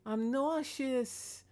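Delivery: whiny cat voice. Retold or imitated. Old cat Salem telling Mama he's nauseous, in a slow high whine. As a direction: plead slowly like a sick cat. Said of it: "cat Salem said".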